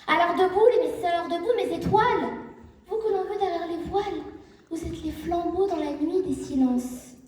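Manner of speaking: rally your sisters, building to a high peak; rallying cry. Real, not acted. Speaker rallies women to stand up. (appel vibrant à se lever, voix qui monte)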